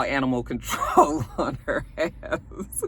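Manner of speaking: cracking up mid-phrase; laughing. Real, not acted. Vlogger recounting an animal control threat, laughing through it. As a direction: break into real laughter while talking.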